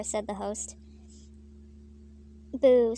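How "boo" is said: loud, drawn-out jeer; crowd boo. A narrator's reading. Crowd boos the opening act, loud mock jeering. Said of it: "said the crowd".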